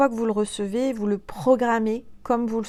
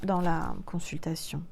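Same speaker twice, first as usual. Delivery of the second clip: whispered; 4 semitones lower, 6 dB quieter than usual. Real, not acted. Trailing whispered correction.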